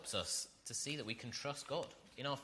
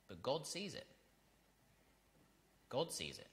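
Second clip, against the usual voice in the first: hushed; 6 dB quieter than usual. Acted. Quiet repeated affirmation.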